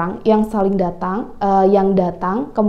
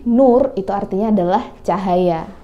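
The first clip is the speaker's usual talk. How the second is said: ordinary talk, nothing marked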